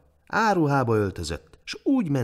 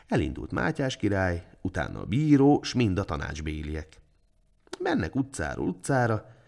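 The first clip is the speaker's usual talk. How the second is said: usual speaking voice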